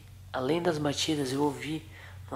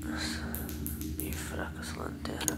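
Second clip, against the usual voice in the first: hushed whisper in the dark; nervous night vlog whisper; 2 semitones lower, 5 dB quieter than usual. Real, not acted. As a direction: whisper so nobody wakes.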